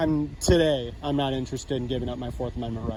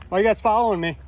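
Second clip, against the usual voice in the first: raised, higher, louder challenge; calling out; 7 semitones higher, 6 dB louder, faster than usual. Real, not acted. Journalist being tailed calls out to the officer, loud and high. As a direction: call out to the officer across the distance. Confronting officer tailing him.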